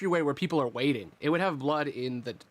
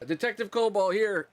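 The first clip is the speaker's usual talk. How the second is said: a lightly raised, chirpy kobold voice; kobold partner; 6 semitones higher than usual. Acted. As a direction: announce yourself brightly. GM voices kobold introducing himself.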